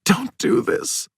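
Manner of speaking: breathy, high, crying whisper; woman pleading with the officer through tears; tearful plea. A narrator's reading. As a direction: beg through tears, slow and barely voiced. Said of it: "she's starting to cry".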